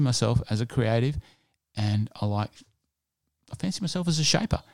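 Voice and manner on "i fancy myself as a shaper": higher, faster mock-proud boast; mock boastful. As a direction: puff it up in a jokey high voice.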